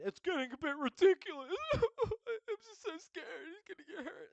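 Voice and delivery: mock him as a whiny crybaby; lampooning a rival team boss as a high whiny crybaby. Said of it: "the biggest fucking crybaby on the grid".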